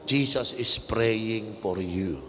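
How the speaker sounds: slow, drawn-out declaration; slow repeat-after-me line. Shown as a demonstration. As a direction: say it slowly to your neighbour. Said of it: "Sabi mo nga sa katabi mo".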